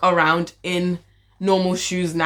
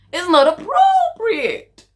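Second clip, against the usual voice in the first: very high, drawn-out mock telling-off; mock scolding; 11 semitones higher, 4 dB louder, much slower than usual. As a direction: squeal it slowly, far above your usual voice.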